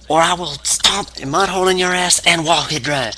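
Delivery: high-pitched mock wrestling threat; Stone Cold Steve Austin. Wrestler warning a rival to share a beer, mock menacing. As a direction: threaten like a wrestler mid-promo. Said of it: "threatens harm if he".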